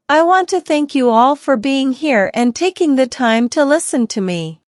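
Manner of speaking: normal talking voice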